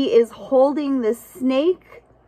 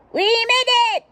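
high, loud triumphant cry; excited cheer; 4 dB louder than usual. Acted. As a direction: cheer like you finally arrived. Animals cheer arrival.

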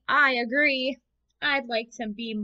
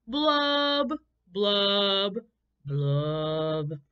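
sad blub blub; 4 dB louder, much slower than usual. Acted. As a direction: let each blub sink down. Fish bubbles, falling pitch.

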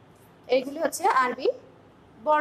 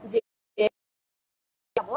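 ordinary talk, nothing marked

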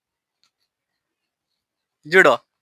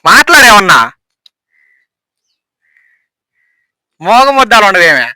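loud demand; 9 dB louder than usual. Real, not acted. Pestering companion who will not answer.

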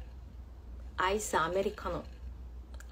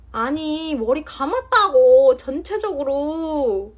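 loud, higher, slowed-down protest; exasperated insistence; 6 semitones higher, 10 dB louder, slower than usual. Real, not acted. Streamer insisting to chat that she showered, exasperated and loud. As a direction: hammer home that she washed.

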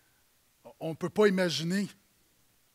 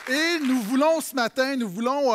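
calling out; 6 semitones higher, 7 dB louder than usual. Real, not acted. Addressing the congregation loudly.

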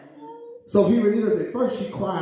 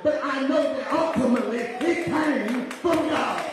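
high, ringing sermon climax over music; whooping preacher voice; preaching at full force; 9 semitones higher than usual. Acted. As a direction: lift it to a shouted church climax.

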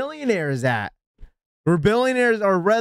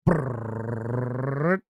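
drum roll; 10 semitones lower, 6 dB quieter than usual. Pretend. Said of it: "Drum roll, please".

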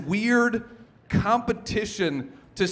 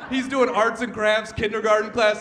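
normal talking voice

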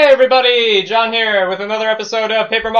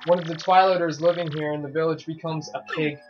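ordinary talk

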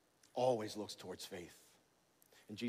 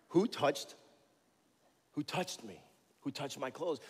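higher, louder searching demand; acted Jesus asking; 4 semitones higher, 4 dB louder than usual. Jesus calls out to the crowd, searching and insistent. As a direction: call out into the crowd.

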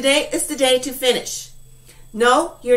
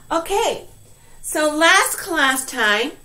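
usual speaking voice

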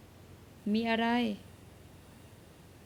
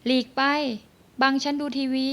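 sharp, louder annoyed retort; irritated snap; 5 dB louder than usual. Acted. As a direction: brush him off angrily. Angry quarrel over the TV. (ไล่ด้วยเสียงหงุดหงิด)

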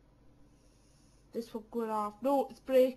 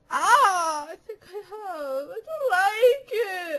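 1 whimpering pain; 6 semitones higher, 4 dB louder, slower than usual. Real, not acted. Bruised wrist, whimpering.

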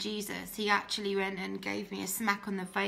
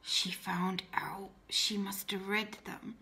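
slow, hushed whisper to herself; hushed inner panic at being found out; panicked whisper; 2 semitones lower, 5 dB quieter, slower than usual. Retold or imitated. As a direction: whisper it in quiet alarm. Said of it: "And I was like".

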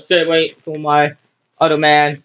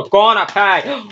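normal talking voice

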